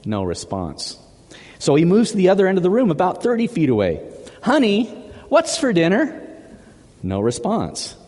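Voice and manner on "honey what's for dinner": high-pitched second call to a wife. Acted. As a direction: call across the room, voice lifted.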